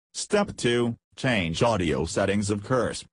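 ordinary talk

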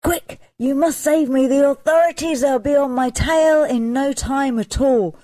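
urgent cry. A narrator's reading. Fleeing Dick begs his landlord friend, urgent cry. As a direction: beg for help with pursuers close behind. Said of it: "he cried to his friend the landlord".

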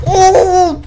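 surprised yelp. Real, not acted. Sudden surprise in game.

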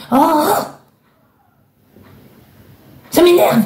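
sudden high burst of real irritation; exasperated outburst. Real, not acted. Tarot reader worked up over the client's situation, exasperated outburst. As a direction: let it jump up, fed up and annoyed. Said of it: "Bon, faut que je me calme".

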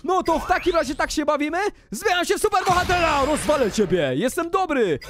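high, hyped-up hero challenge; battle declaration. Pretend. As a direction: declare war like a play superhero. Declaring a superhero fight.

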